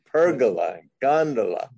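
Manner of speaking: pergola and gondola both stressed on the first syllable; corrected pronunciations. Shown as a demonstration. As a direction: say PER-go-la for pergola, GON-do-la for gondola. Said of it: "English and Italian pronunciation is".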